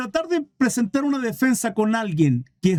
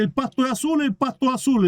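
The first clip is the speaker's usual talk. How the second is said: higher-pitched, insistent donkey voice; 5 semitones higher than usual. Acted. Donkey arguing with the tiger, high insistent voice. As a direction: act out a stubborn donkey, pitched up. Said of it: "El burro le decía al tigre".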